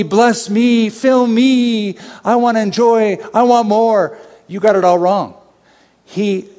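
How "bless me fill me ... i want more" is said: high, chanting list of demands; hammed-up needy believer; mock self-centred pleading. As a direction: plead greedily in a higher mock voice.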